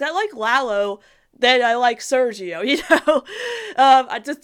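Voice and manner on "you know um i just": breaking into a laugh mid-sentence. Real, not acted. Fan laughing to viewers about loving Lalo. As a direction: giggle through the words.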